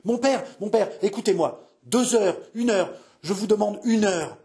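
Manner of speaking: high, urgent pleading; desperate cry. Acted. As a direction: beg your father frantically for time. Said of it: "cria François en se tordant les mains". (supplication criée, désespérée)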